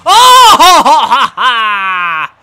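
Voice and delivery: real joy bursting out at a strikeout; ecstatic shout and laugh. Real, not acted. Gamer celebrating his big strikeout, shouting and laughing. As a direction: explode into a high, loud whoop and cackling laugh. Big play in baseball game.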